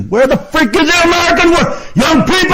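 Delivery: real furious screaming, pitched very high; high, strained angry yelling; upset screaming rant. Real, not acted. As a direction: scream at them in outrage, dragging out the insults. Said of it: "I should not have to get upset at people".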